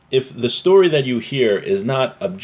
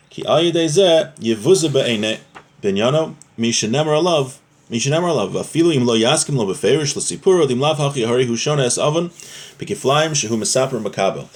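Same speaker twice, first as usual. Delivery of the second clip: reading the text aloud; 4 semitones higher than usual. Acted. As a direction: chant the Hebrew source like a study hall reading. Reads Hebrew source text aloud.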